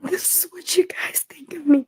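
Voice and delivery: broken tearful whisper; whispering through tears to her viewers. Real, not acted. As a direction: breathe it out, barely voiced. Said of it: "crying streamer whispers to herself".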